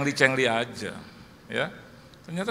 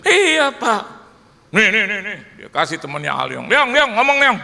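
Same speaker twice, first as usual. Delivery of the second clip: louder, higher calling out; pushy; 4 semitones higher, 7 dB louder than usual. Retold or imitated. Friend loudly passes the phone to Aliong. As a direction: hand over the phone eagerly.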